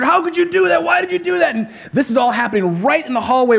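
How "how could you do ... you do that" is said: put-on much higher, whiny upset boy voice; upset kid. Pastor imitates his upset son scolding his sister, high and whiny. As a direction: play the wronged little brother, pitched way up.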